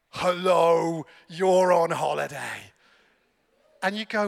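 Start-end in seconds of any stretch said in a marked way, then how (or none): 0.1-2.7 loud, low, drawn-out booming call; mock scream. Mountain screams at holidaymakers in the Alps, booming. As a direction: boom it out slowly as a mountain. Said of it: "it literally screams at you".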